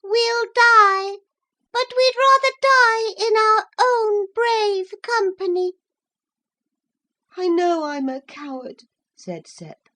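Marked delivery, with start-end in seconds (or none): 0.0-5.7 very high, louder resigned little voices; very high thin voices, bravely resigned; brave tiny mussel voices. A narrator's reading. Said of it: "thin, sharp voices". 7.4-8.8 put-on high child voice; a hint of a shamed boy; ashamed little boy. A narrator's reading.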